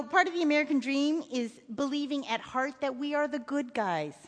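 ordinary delivery, nothing marked

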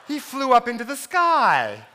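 put-on a small child soothing her sister, slowly; three-year-old explaining the deer to her sister. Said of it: "she goes".

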